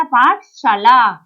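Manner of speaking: पाठशाला slow and loud with a long आ in पा; teacher correcting a misspelling, slow and emphatic; correct pronunciation. Shown as a demonstration. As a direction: stretch the आ in पाठशाला: पा-ठ-शाला. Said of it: "प पर आ की मात्रा".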